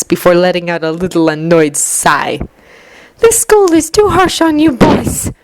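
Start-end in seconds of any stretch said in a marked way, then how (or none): 3.2-5.3 grumbling. A narrator's reading. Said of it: "she grumbles".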